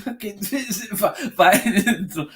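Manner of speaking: high, helpless laughter through the words; laughing while talking. Real, not acted. Telling how she dropped it back in the drawer, laughing hard. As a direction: barely get it out for laughing. Cracking up retelling daughter's reaction.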